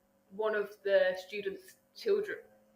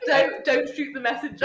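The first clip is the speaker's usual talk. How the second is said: high, louder mock protest; raised put-on voice, half laughing; Carol impression; 6 semitones higher, 6 dB louder than usual. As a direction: mimic her pleading innocence through laughter. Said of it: "did she do the whole thing where she was like".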